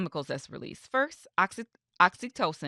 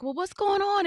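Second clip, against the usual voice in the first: imitating a concerned friend, much higher; pitched-up mock concern; worried friend; 12 semitones higher than usual. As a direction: friend asking what's wrong, a higher worried voice.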